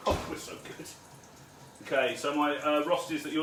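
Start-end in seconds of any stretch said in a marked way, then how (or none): 0.1-0.9 whispered to self; whispered self-praise; whispered aside. Real, not acted. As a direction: breathe it out under the breath.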